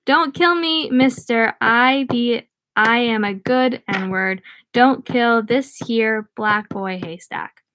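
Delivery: ordinary delivery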